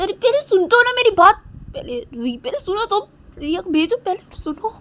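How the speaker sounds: high, pushing plea; husband begging his wife to listen first; urgent pleading. Acted. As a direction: cut in, begging to be heard. Man pleads again.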